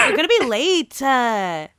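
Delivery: high, loud whiny "uh" tag; whiny tantrum. Retold or imitated. Friend's tantrum voice, high and whiny. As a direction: whine like a sulky teen. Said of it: "That's, like, my tantrum voice".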